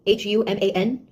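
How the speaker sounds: each letter of H U M A N said slowly and separately; H U M A N spelled like to a slow listener. Shown as a demonstration. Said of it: "I was talking to a human".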